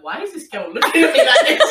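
high, laughing mock bewilderment; imagined puzzled guy. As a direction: play his confused reaction, laughing. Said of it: "He was probably like".

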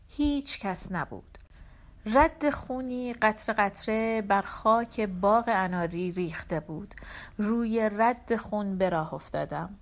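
ordinary delivery, nothing marked

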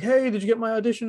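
pestering auditioner. Retold or imitated. Actor messaging the casting director about an audition, mock pestering. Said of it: "don't message the person and be like".

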